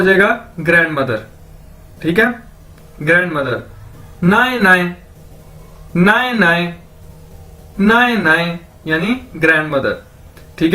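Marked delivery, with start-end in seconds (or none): 4.2-6.7 नाए नाए repeated clearly, even syllables; teacher repeating नाए नाए; pronunciation demo. Shown as a demonstration. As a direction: drill नाए नाए for grandmother. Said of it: "तो अगर इसको दो बार बोला जाए".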